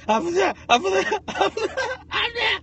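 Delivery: high loud mock sneezing; hammed-up sneeze noises; imitated sneezes. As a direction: act out a row of sneezes. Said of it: "I did six in a row".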